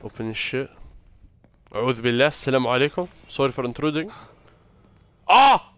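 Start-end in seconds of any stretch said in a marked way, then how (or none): 5.3-5.7 sharp high scream of fright; sudden shriek, caught off guard; startled scream. Real, not acted. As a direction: yelp as the jumpscare hits. Jumpscare in horror game.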